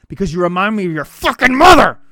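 building to a loud, bitter yell; acted fatherly rant that erupts at the end; angry outburst. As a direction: lash out loudly as the fed-up father. Acted father's rant at his daughter.

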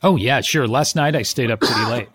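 ordinary talk

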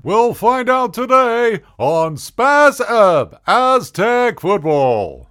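high, loud hammed-up stadium announcer; team announcer. Comedian mocks the team announcer, high loud announcer voice. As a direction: boom it out like a sports PA announcer. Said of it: "they'd be like".

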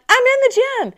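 high, emphatic claim; insistent protest. Retold or imitated. She insists to the friends, high emphatic claim. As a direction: insist with a laugh. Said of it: "I'm like".

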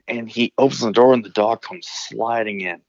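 ordinary delivery

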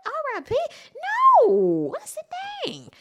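high startled what; shocked disbelief. Retold or imitated. Seeing the post, she reacts in high shock. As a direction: gasp at the news. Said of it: "and I said".

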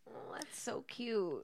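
breathy whispered aside; hushed admiring aside. Real, not acted. Soft aside reaction.

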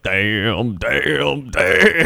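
mock rapturous burger guy, building; imitating viral burger guy. Retelling a viral clip, building mock damn damn damn. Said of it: "the guy that ate the Five Guys burger".

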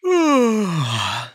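long drawn-out yawn. Real, not acted. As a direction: let a yawn take over. Talk about contagious yawning.

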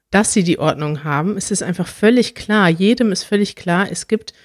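ordinary talk, nothing marked

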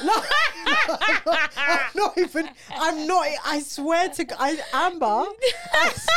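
high, loud giggling through the words; can't get the words out for laughing. Real, not acted. Telling the Wireless story to her co-host, helpless laughter.

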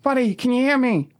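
high-pitched worried questioning; acted tentative concern; Johannes, anxiously pleading. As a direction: coax a fallen friend awake. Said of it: "And he goes".

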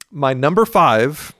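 ordinary talk

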